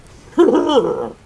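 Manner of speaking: loud, high rolling monster growl; Bowser growl. Acted. Streamer retrying Bowser for the mic, growl. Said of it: "I don't even know if the mic picked that up".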